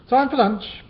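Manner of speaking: high, loud and breathless; puffing out the words, out of breath; panting. Acted. Miss Moo Hoo calling the group to lunch, panting. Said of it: "panted Miss Moo Hoo".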